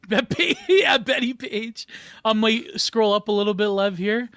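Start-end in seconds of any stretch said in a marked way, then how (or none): 0.1-1.9 laughing. Real, not acted. Laughing while reading chat.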